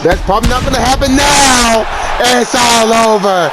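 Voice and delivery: high-pitched, animated knockout call; excited play-by-play. Acted. Commentator calling Stipe's emphatic knockout of Tim, excited play-by-play.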